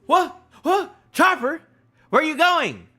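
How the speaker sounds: high, loud, frantic exclamations; real high-pitched panicked excitement; excited alarm. Real, not acted. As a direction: cry out as the action unfolds. Alarmed excitement at Chopper in manga.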